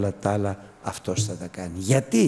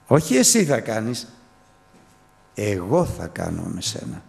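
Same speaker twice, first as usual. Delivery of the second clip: ordinary talk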